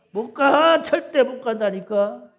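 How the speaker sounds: preacher shouting his refusal to the congregation; emphatic shout. Real, not acted. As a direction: pound out the refusal. Preacher insists loudly.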